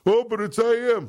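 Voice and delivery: high, dismissive listener whine; mock listener voice. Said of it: "I mean, it's".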